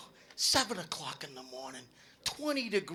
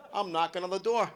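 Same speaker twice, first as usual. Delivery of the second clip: ordinary talk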